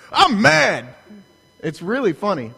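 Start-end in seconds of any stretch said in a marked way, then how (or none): 0.1-0.9 higher, louder burst of mock rage; hammed-up mad coach; mock angry coach. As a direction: bark it like a furious coach. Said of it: "and he's like".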